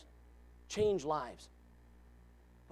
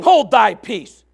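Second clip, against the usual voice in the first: much louder, slow commanding rebuke; stern rebuke; 2 semitones higher, 14 dB louder, slower than usual. Acted. Jesus rebuking the devil, slow and commanding. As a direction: command silence with authority. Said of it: "Jesus rebuked him".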